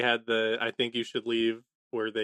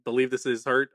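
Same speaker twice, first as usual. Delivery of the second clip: ordinary talk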